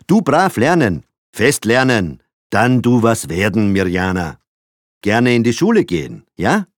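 high, loud talking-down voice in pidgin German; condescending pidgin German; broken German to a foreign child. A narrator's reading. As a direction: speak simplified German like to a foreigner. Father speaks broken German to child.

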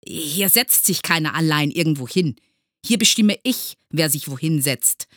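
loud, cutting authority, peaking midway. A narrator's reading. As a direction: lay down the law sharply. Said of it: "sagte Groll schneidend". (schneidend und herrisch)

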